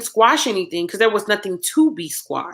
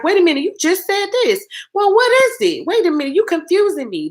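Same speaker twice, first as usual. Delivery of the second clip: confused viewers; 10 semitones higher than usual. Retold or imitated. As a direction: mimic confused viewers, pitched way up. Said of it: "People are just like".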